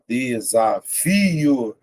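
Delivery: "desafio" very slow by syllables, leaning on FI; stress on FI. Shown as a demonstration. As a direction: split de-sa-FI-o and stretch the strong FI. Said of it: "é a sílaba mais forte".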